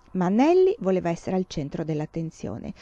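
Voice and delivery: ordinary delivery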